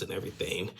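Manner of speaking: ordinary talk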